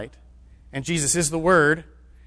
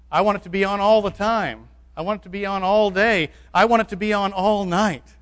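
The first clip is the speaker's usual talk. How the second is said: higher and louder, pressing each line; fervent repetition; 5 semitones higher, 4 dB louder than usual. Real, not acted. Preacher urging his church, fervent and raised. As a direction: drive the repeats home with fervour.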